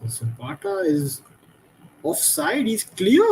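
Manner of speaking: rising shout of alarm; excited. Real, not acted. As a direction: yell it as the chance breaks. Live chance, shouting offside call.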